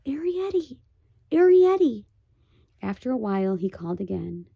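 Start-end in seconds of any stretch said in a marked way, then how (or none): 0.0-2.1 calling Arrietty down the hole, rising; boy calling Arrietty. Acted. The boy calls Arrietty down the hole, rising and louder. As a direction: call the name into the dark hole. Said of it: "He ca-, called softly".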